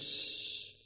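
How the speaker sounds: soft unvoiced shush. A narrator's reading. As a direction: hush the newcomer, finger to lips. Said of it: "places her finger on her lips and she says".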